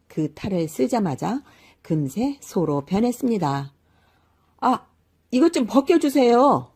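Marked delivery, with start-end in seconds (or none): 4.6-6.7 high, loud panicked plea; a man shocked to be turned into a cow; startled cry. A narrator's reading. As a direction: cry out in sudden alarm. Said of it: "깜짝 놀란 게으름뱅이가 소리쳤지만".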